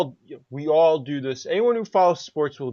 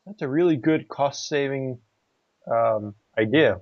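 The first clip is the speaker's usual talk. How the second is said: searching for words. Real, not acted. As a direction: hesitate mid-phrase, groping for the word. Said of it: "Where'd my vocabulary go?".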